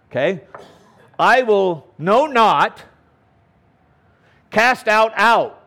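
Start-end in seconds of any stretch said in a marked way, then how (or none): 1.2-5.6 raised, louder, each "not" and "out" hit; emphatic double negative. Shown as a demonstration. Teacher to class, hammering the double negative. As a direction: punch the doubled words. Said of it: "that double is for emphasis".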